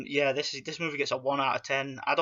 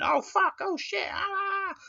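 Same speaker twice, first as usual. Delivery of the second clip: much higher cartoon yelps of swearing; mock shouting. Parodying South Park shouting, hammed-up high yell. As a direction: yell like a squeaky cartoon kid. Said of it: "It's all shouting".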